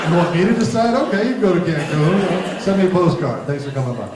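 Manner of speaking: loud, high, hammed-up send-off; mock breezy dismissal. Therapist jokingly takes over mom's decision, mock breezy dismissal. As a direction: play it big, cheerfully washing your hands of it.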